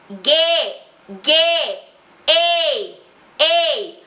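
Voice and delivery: bright, pointed twangy "ei"; edge twang demo. Shown as a demonstration. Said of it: "Todas as vogais vão ganhar um E".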